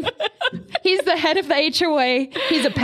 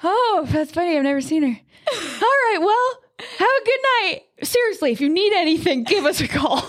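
chirpy neighbor; 6 semitones higher than usual. Retold or imitated. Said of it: "And he goes".

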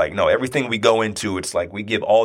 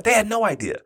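They raised high on repeat; emphatic repeat; 10 semitones higher than usual. Real, not acted. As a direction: lift "They had no idea" higher. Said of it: "repeated with emphasis".

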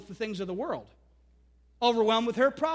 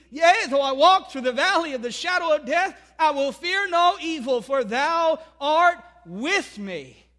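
solemn scripture reading; 4 semitones higher, 6 dB louder than usual. Acted. Quoting Psalm twenty-three aloud.

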